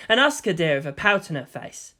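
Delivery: ordinary delivery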